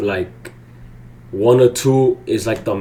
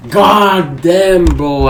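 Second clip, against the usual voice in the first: angry groan; 7 semitones higher, 7 dB louder, much slower than usual. Real, not acted. Regrets the trade.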